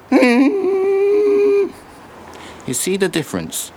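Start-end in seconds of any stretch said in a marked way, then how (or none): 0.1-1.7 sudden high squeak, like a tiny voice; a silly high-pitched soup sound; squeaky soup noise. Acted. To a stranger at the bus stop, a falsetto squeak.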